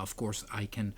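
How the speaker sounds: usual speaking voice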